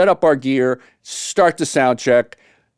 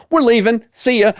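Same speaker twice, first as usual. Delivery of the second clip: higher, carefree mock goodbye; breezy exit; 9 semitones higher than usual. Headline band telling Fred they're leaving, breezy and higher. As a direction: toss it off like you don't care. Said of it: "they said".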